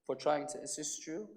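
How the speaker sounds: subdued voice of someone excusing himself; meek apology. Retold or imitated. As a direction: back down quietly and politely. Stranger apologizes after being scolded.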